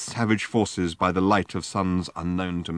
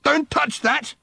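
yelling; slower than usual. Acted. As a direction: yell to stop him. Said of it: "yelled Ford".

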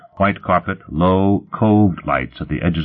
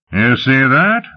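higher-pitched boastful exclamation; crowing; 7 semitones higher, 4 dB louder, slower than usual. A narrator's reading. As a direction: crow with a touch of triumph. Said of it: "Lot crowed".

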